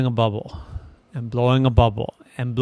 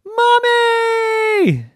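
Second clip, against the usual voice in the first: very high, loud drawn-out scream; screaming child; 6 dB louder than usual. Acted. Irene bursting out of the bubble at Mommy, screaming. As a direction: scream it out like a little kid. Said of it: "And Irene screamed".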